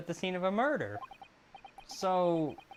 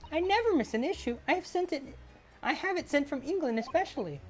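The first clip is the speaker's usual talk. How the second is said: put-on higher, eager young woman; young lady's voice; 10 semitones higher than usual. Assistant gushing to Naruhodo about the famous detective, higher eager voice. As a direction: gush like an admiring young assistant.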